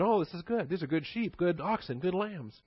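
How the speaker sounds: high, pleased voice admiring the sheep; self-satisfied Saul. Retold or imitated. Saul gushing over spared sheep and oxen, pleased. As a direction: gush over the good livestock.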